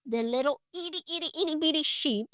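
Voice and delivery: put-on a light touch of baby talk; cutesy baby talk. Preacher playing up how tiny the lamb is, cutesy. Playfully describing tiny helpless sheep.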